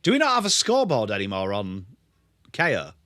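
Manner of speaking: usual speaking voice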